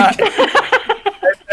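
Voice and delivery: sudden squealing laugh; high burst of laughter. Real, not acted. Bursts out at the joke.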